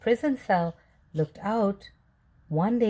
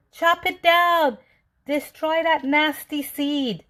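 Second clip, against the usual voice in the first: bellowing; 8 semitones higher, 4 dB louder than usual. A narrator's reading. The mayor bellowing at the seed from his palace. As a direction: roar the order to destroy it. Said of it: "and now he bellowed".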